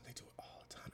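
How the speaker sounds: hushed, unvoiced whisper of annoyance; breathless whisper, I hate it; whispered frustration. Real, not acted. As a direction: whisper it like a confession you hate.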